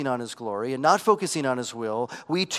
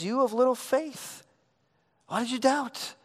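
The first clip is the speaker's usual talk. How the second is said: higher, gentle questioning rebuke; voice of Jesus; 9 semitones higher than usual. Acted. Preacher voicing Jesus to sinking Peter, higher and gentle. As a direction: ask it as Jesus, gently, lifting up.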